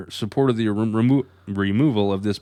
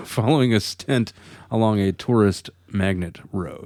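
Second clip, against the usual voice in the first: ordinary talk, nothing marked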